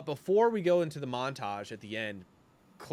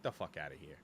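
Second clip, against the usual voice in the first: low dismissive mutter; 8 semitones lower, 14 dB quieter, faster than usual. Retold or imitated. Acting Cassian dismissing them.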